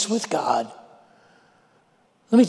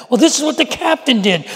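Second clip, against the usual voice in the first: ordinary talk, nothing marked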